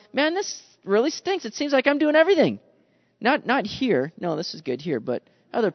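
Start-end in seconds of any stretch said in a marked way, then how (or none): 0.1-2.6 higher, faster fed-up whine; grumbling complaint. Retold or imitated. Preacher retelling his own stinky attitude, whiny. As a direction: moan that you do everything.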